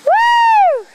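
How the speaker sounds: loud high whoop of joy; excited cheer. Real, not acted. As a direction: cheer him on with a big whoop. Cheering kid's bike ride.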